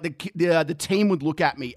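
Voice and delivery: ordinary talk, nothing marked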